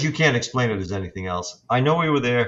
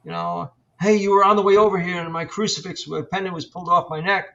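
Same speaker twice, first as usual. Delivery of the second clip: higher-pitched imitation of a client; raised-pitch mimicry of someone telling what happened; excited client; 7 semitones higher than usual. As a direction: act out a householder's alarmed report. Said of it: "persons would say".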